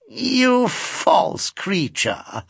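mock-scolding old lady, high and slow; insipid old lady. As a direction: drag it out, coy and insipid. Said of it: "said the old lady in the chair insipidly".

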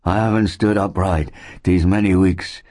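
weakened invalid's character voice; faint answer. A narrator's reading. Ailing settler explains his weakness to Mark, faintly. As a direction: speak as one worn down by fever. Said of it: "he answered faintly".

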